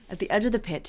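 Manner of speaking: plain reading voice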